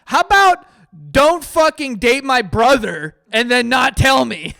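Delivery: very loud, high acted rant; mock yelling. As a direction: yell out an outraged post, hammed-up. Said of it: "They're, like, yelling through this thread".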